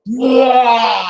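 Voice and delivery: high shouted whoop; distant cheer. Retold or imitated. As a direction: whoop like troops cheering far below. Cheer heard down elevator shaft.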